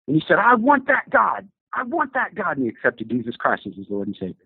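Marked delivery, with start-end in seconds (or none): 0.4-1.5 high, slow pleading; higher, slow desperate plea. Retold or imitated. Son-in-law converts. 1.7-2.6 high, softer, falling plea; higher and quieter plea; softer repeated plea. Retold or imitated. Son-in-law converts.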